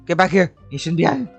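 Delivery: ordinary talk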